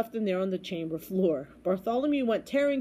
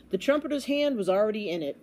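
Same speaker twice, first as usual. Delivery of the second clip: ordinary delivery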